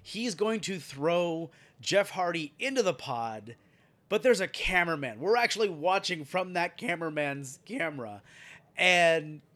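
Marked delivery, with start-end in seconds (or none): none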